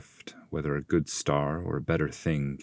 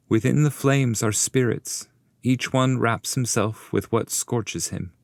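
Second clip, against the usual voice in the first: raised, earnest guide voice explaining; Virgil the guide; 7 semitones higher than usual. Acted. Guide explaining the flames to his pupil, earnest raised voice. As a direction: speak up as the patient master.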